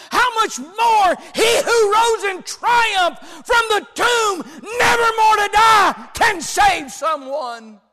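high, loud, impassioned sermon voice; preacher at the peak of his sermon; full-force preaching. Acted. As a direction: thunder it out to the congregation. Preaching climax.

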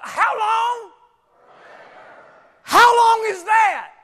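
loud, high, slow shouted preaching; preaching at full force. Acted. Preacher pressing congregation on how long, shouting. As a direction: drive it home to the back row.

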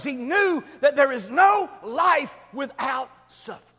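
loud, high-pitched, emphatic sermon delivery; full-force preaching. Real, not acted. Preacher corrects a false promise to the congregation, full-force preaching. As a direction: drive each point home at full voice.

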